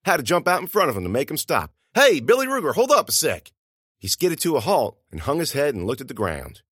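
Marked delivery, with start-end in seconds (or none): 1.9-3.5 high, loud shout to catch someone; calling out. Acted. Narrator's student calls after Billy Ruger to stop him, calling out. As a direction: holler after someone hurrying off.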